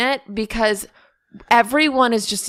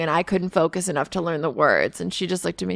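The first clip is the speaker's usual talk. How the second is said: ordinary talk, nothing marked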